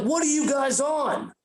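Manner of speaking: high-pitched mock parental scolding; angry parent. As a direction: demand answers like an exasperated parent. Parents confront the tripping boys.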